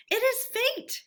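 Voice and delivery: high, fated sigh of a voice; Toad to himself. A narrator's reading. Toad muttering to himself, high and resigned. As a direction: give in to the craving.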